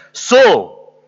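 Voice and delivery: loud, commanding voice from above; booming divine reproach; voice from heaven. Retold or imitated. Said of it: "there was this voice that came from heaven".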